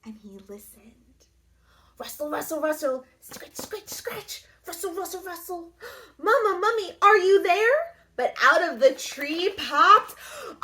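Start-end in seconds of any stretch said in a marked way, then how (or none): 0.0-1.0 hushed storytelling whisper. A narrator's reading. Narrator pauses the story in a whisper. 3.6-5.7 quiet, higher scratchy rustle; soft rustling sound. A narrator's reading. Narrator makes quiet tree rustles. 6.2-8.0 high, small calling voice, getting louder; a tiny mummy calling for mama; little baby mummy. Acted. Baby mummy calls into the woods, high and loud.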